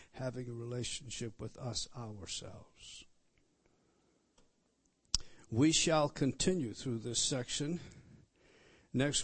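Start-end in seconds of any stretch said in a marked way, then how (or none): 0.1-3.0 soft intimate whisper. Real, not acted. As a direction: breathe the last words to them. Hushed close of the teaching.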